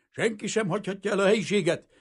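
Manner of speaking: high, loud, rapid announcement; shouted order. A narrator's reading. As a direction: shout it over a panicking crowd. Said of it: "valaki ordított". (ordított, sietős parancs)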